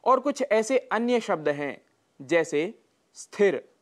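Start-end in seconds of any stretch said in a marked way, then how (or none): 3.1-3.7 no vowel before the cluster in स्थिर; correct pronunciation. Shown as a demonstration. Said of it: "शुद्ध शब्द है स्थिर". (स्थिर का शुद्ध उच्चारण)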